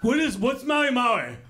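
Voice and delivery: mock the rude customer, dragging out the last Maui Maui; hammed-up rude restaurant guest; rude customer imitation. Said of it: "And they're just".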